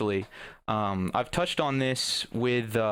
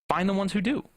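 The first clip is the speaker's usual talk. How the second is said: "Find the ones who do" repeated higher, lifting on "do"; higher encouraging echo; 7 semitones higher than usual. Real, not acted. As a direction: echo it brighter, rising at the end.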